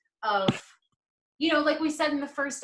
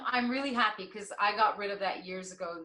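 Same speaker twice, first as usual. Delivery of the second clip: ordinary delivery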